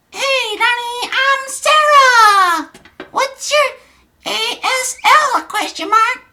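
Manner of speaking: very high, slow put-on girl voice; girl's chat voice. Streamer voicing Sarah's chat message to Donnie, high girlish voice. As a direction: drag it out in a high falsetto. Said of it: "forgive my voices".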